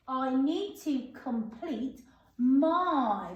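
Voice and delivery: drawn out, word by word; slow read-back. Shown as a demonstration. Teacher reading back the sentence slowly to pupils. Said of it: "reading sentence slowly while writing".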